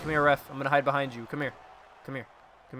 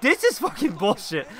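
higher, exasperated protest; indignant complaint; 6 semitones higher, 3 dB louder than usual. Real, not acted. Player fed up with the match, indignant complaint. Same complaint repeated with more force.

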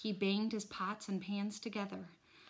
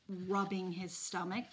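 ordinary delivery, nothing marked

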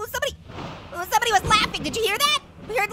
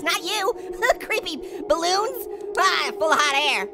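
usual speaking voice

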